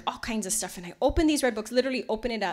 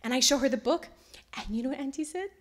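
ordinary delivery

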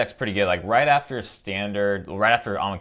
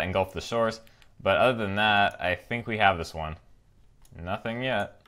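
ordinary talk

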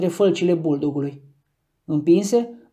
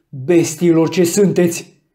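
slow, louder furious yell; slow angry cry of disgust; 6 dB louder, slower than usual. A narrator's reading. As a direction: shout it at the dogs with each breath. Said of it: "continua să strige".

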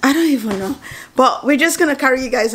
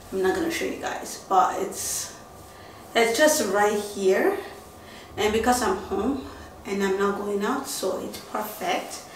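soft, half-whispered; hushed; 7 dB quieter than usual. Real, not acted. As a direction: drop to a near whisper. Hushed talk about shirt.

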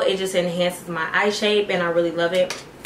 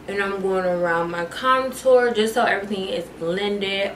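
usual speaking voice

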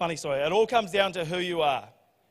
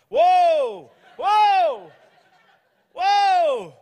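high, loud whoas swooping down; imitated horse whisperer; 10 semitones higher, 8 dB louder, much slower than usual. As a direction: mimic a man trying to halt horses. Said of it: "and he's like".

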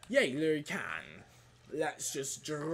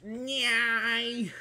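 weird squeak; 6 semitones higher than usual. Pretend. Odd noise, then asks its origin.

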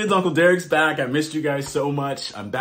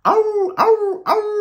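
very high, loud yowls; howling dog; 4 dB louder, slower than usual. A narrator's reading. Reading to kids, howling like a Brazilian dog. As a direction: howl like a small dog. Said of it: "Dogs in Brazil bark".

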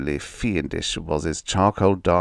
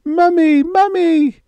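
put-on piercing little-girl scream; panicked child's shriek; 9 dB louder, slower than usual. A narrator's reading. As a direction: scream for mother, very high and loud. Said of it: "Emily shrieked".

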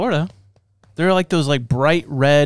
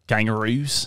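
put-on Australian accent; 3 semitones lower, 4 dB quieter than usual. Playful Australian accent bit.

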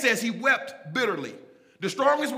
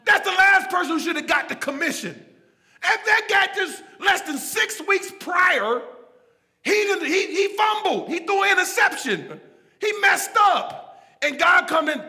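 high, loud pulpit cry; impassioned preaching; 10 semitones higher, 9 dB louder than usual. Real, not acted. Preacher marvelling that Peter got the call, high impassioned preaching. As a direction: cry it out to the congregation.

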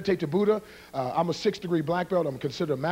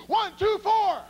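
high-pitched calling count; shouted count-in; 14 semitones higher, slower than usual. Real, not acted. Karate man cueing everybody's kia, high shouted count. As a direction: call the count to rouse the room.

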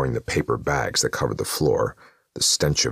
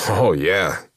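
slippery driver; 6 semitones higher, 5 dB louder than usual. A narrator's reading. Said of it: "with a slippery laugh".

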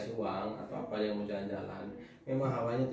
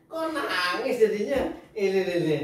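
teasing; 6 semitones higher, 4 dB louder than usual. Real, not acted. Teasing a crying child.

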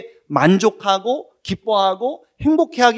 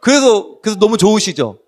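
ordinary talk, nothing marked

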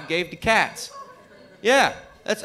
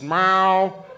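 cat yowling; 2 semitones higher, much slower than usual. Retold or imitated.

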